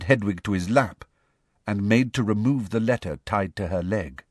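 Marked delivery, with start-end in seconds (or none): none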